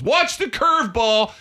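high, slow shouted warning; dugout shout. Retold or imitated. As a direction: yell it from the dugout. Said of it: "the guy in the dugout goes".

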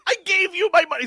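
high, loud hammed-up wail; mock crying son. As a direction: wail at your dad like a betrayed son. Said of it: "like, crying".

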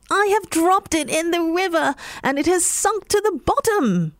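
wailing over the lost charm; distressed cat. A narrator's reading. Cat telling the dog of the lost charm, distressed.

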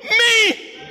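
desperate short prayer. Acted. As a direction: cry out for rescue, acting desperate. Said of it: "His prayer was short".

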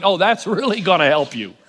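low dry sarcastic jab; mock his own answer, deadpan. Mocking his own advice.

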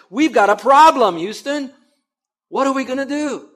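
high, loud cry for help; mock alarm. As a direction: play up a panicked call to mission control. Acts out crowd's alarmed plea.

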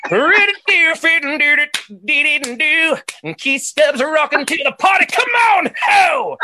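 high scat-singing rock frontman with shouts. Acted. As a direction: scat wildly high, then shout to the crowd.

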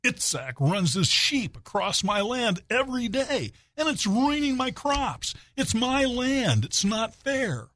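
high, drawn-out complaint; hammed-up aggrieved plea to the rabbi; pleading villager. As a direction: plead the case with rising, slow indignation. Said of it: "pleads his case".

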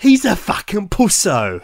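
high, hammed-up Cockney jeer at "pusso"; mock Cockney voice. Friend mocks the cowardly cop in high Cockney jeer.

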